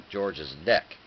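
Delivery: ordinary delivery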